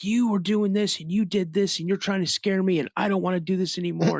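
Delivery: high, loud, tearful accusation; upset yelling back. Retold or imitated. Frightened boy yelling back at his dad, high and loud. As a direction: throw it back at him, shaken and angry. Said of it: "I just give it back to him".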